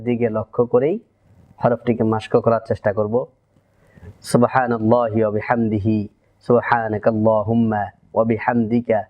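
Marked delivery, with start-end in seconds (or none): 4.3-9.0 closing prayer. Acted. Closing supplication.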